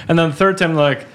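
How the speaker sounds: ordinary talk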